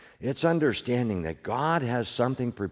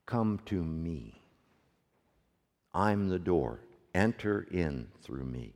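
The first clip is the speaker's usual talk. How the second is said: gentle invitation; 6 semitones lower, 8 dB quieter than usual. Retold or imitated. Pastor voicing Jesus inviting sinners, soft and tender. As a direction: draw them in gently, as Jesus.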